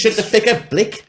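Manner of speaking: high, loud mock Australian accent, pitched well up; Australian twang hammed up for laughs. Mocking Tarantino's Australian accent, high and hammed. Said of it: "Australian accents are funny".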